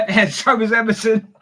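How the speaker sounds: amused, chuckling as you say it; laughing. Real, not acted. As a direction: laugh through the words. Joking about the coming goal.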